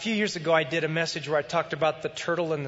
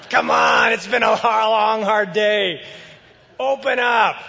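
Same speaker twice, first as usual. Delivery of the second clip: begging husband; 4 semitones higher, 8 dB louder than usual. Acted. Husband begging his wife to open the door, loud and whiny. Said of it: "He's begging".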